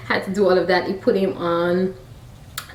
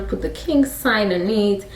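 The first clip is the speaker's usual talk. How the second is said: usual speaking voice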